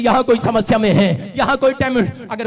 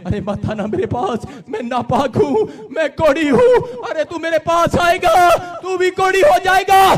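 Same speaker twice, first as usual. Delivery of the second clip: crying leper warning; 6 semitones higher than usual. Acted. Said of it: "रो रहा है और चिल्ला रहा है".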